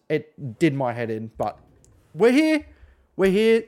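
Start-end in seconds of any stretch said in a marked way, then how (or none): 2.1-3.6 high, loud exclamation; mock-triumphant cheer. Real, not acted. Host announcing the delayed show finally happens, high celebratory cry. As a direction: call it out like a celebration.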